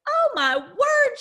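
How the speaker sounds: high, loud, drawn-out astonishment; amazed outburst. Retold or imitated. Mom marvelling at her daughter's change, amazed. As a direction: exclaim it high and slow, floored.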